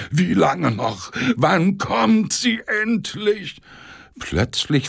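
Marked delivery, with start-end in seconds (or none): 0.1-3.6 high, slow, drawn-out plea; moaning impatience. Acted. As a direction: ache with impatience. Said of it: "stöhnte Semjonitsch".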